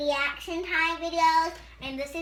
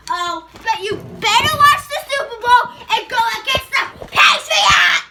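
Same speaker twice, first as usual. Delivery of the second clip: loud rising yell; shouting; 8 semitones higher, 14 dB louder than usual. Real, not acted. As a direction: belt it out at the end. Shouting at the viewers.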